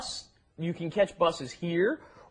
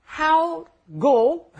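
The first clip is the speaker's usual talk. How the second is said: mock broken English; 9 semitones higher, 7 dB louder than usual. Shown as a demonstration. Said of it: "literal gloss demo".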